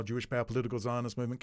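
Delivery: usual speaking voice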